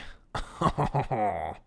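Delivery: hammed-up French chortle; French chef laugh. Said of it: "my French accent is unrivaled".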